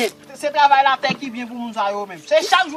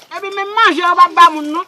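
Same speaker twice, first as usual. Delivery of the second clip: ordinary delivery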